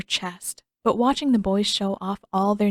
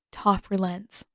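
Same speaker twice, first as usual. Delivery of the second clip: plain reading voice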